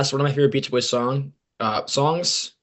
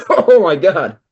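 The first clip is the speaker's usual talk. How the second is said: laughing; 7 semitones higher, 10 dB louder, slower than usual. Real, not acted. Laughing after pizza joke.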